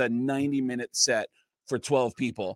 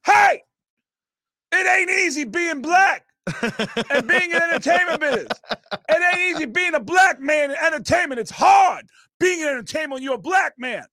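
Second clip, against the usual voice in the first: high, loud, angry mock shouting; imitating yelling; 13 semitones higher, 5 dB louder than usual. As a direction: rant back at the laughing crowd. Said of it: "starts yelling".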